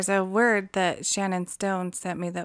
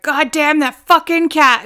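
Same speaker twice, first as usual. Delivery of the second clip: angry curse; 7 semitones higher, 7 dB louder, slower than usual. Retold or imitated. Retold cursing at smelly cat.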